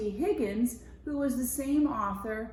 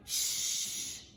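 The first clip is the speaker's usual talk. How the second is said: sharp unvoiced shh; shushing; 4 dB quieter than usual. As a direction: hush them fast. Rupert hushes friend.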